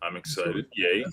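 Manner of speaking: deadpan, unenthusiastic cheer; mock excitement. Real, not acted. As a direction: feign excitement with no enthusiasm. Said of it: "You sound excited".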